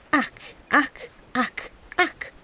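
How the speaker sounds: soft, high croaking; frogs crying. A narrator's reading. Frogs refusing to budge, soft croaking. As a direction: keep croaking unbothered. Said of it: "continued to cry out".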